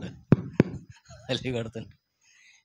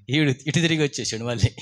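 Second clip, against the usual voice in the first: ordinary talk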